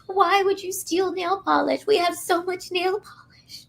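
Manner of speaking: high, slow mock mom voice; imitating mom. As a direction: play the exasperated mom. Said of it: "my mom was on the phone".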